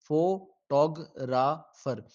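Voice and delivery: slow, one syllable at a time; split into syllables. Shown as a demonstration. Teacher dividing a word for the class, slowly. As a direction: say photographer slowly as fo-to-gra-far, each beat apart. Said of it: "syllable".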